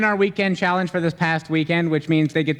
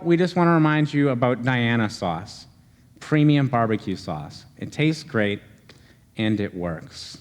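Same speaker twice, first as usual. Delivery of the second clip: ad read; 4 semitones lower than usual. Acted. Mock ad read.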